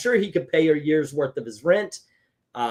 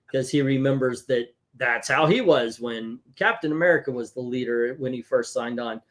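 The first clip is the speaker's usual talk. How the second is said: usual speaking voice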